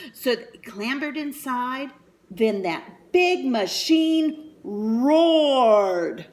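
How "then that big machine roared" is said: slow, loud, booming; roaring. A narrator's reading. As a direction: boom it out like the machine. Machine roaring in story.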